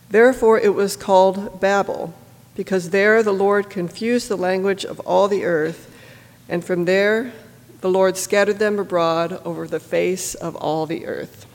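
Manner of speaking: ordinary talk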